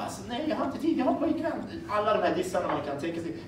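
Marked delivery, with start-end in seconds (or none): 0.0-1.5 put-on girl brushing off. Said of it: "Hon bara".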